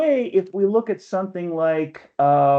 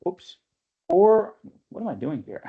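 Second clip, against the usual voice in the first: ordinary delivery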